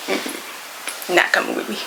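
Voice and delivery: hushed, voiceless no; whispered refusal. Retold or imitated. Telling the cat it isn't coming along, in a whisper. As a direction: whisper it like a firm secret no.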